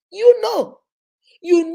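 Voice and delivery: emphatic cry. Real, not acted. Insisting he knows.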